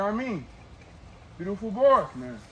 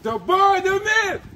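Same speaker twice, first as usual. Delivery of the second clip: hype intro; 12 semitones higher, 5 dB louder, slower than usual. Real, not acted. Hyping up his prize dog.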